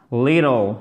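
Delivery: "Little" with its T almost a D; American flapped T. Shown as a demonstration. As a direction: flap the T in "Little", American style. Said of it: "ça se prononce presque comme un de". (« Little » avec le T prononcé presque comme un D)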